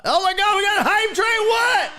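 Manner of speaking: excited shouting. Real, not acted. Streamer reacting to a hype train, excited shouting. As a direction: shout it high, stretching the big words.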